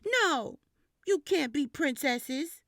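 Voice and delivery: put-on child bossing a friend loudly; bossy refusal. A narrator's reading. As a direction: lay down the law to a playmate. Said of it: "I kept saying".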